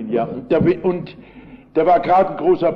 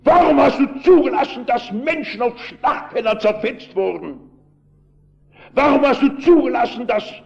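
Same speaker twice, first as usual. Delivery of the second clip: angry shouting miner; 6 semitones higher than usual. Retold or imitated. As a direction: rail at God like an angry miner on a soapbox. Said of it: "So schrie der Mann".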